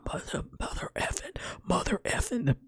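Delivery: raspy, unvoiced bird swearing; cussing parrot. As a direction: imitate a parrot cussing under a harsh whisper. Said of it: "the bird was cussing like a sailor".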